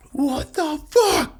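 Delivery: slow, very high outraged bark; furious. Retold or imitated. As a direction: drag it out in pure outrage. Furious senior sergeant erupting.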